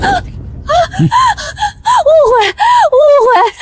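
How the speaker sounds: panicked cry. Acted. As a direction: beg them to believe it's a mistake. Bride panics before in-laws. (带着哭腔慌乱喊冤)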